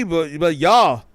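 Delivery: ordinary delivery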